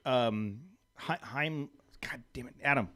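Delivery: ordinary talk, nothing marked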